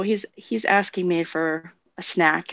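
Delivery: ordinary talk, nothing marked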